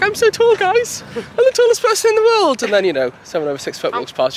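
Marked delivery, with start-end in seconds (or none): 0.0-2.6 much higher, playful boast; child boasting of height. Retold or imitated. Woman voicing a child on someone's shoulders, high mock boasting. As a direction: imitate her in a high little voice. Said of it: "She's like".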